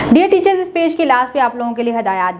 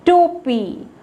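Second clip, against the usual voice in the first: put-on model word for children; 6 semitones higher, much slower than usual. Shown as a demonstration. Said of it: "टोपी क्या है?".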